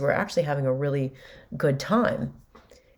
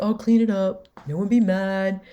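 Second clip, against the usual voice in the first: higher, louder, overdone self-pity; dramatic whine; 5 semitones higher, 3 dB louder than usual. Retold or imitated. Son dramatically protesting to his stepfather, higher and louder. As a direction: ham up the wounded son. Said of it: "he was just getting very dramatic".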